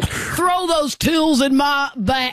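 slow drawn-out cowboy call; Jeff Bridges voice. Pretend. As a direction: drag it out like a cowboy. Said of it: "Like Jeff Bridges from True Grit".

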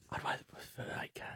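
breathy murmur aside; chuchoté en aparté; whisper. Real, not acted.

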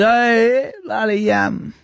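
chirpy blokey impression of a mate; Smith Tech impression. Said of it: "Smith Tech impression".

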